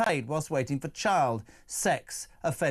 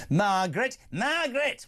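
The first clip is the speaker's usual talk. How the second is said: mock calling out; 7 semitones higher than usual. Playfully calling out a name.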